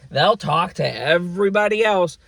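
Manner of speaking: usual speaking voice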